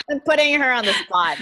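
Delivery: laughing while talking. Real, not acted. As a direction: tease her while still laughing. Teasing colleague, laughing.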